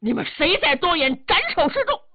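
high, angry shouted order; furious commander's threat. Acted. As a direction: glare and silence the troops. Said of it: "吴汉这回瞪眼了".

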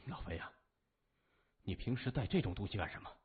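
lightly voiced stunned disbelief; dazed question. A narrator's reading. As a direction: ask as if stunned past reacting. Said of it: "三眼儿木然地问".

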